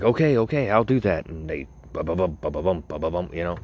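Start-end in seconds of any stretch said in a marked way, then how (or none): 0.0-1.3 eager prince. Pretend. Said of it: "he's like".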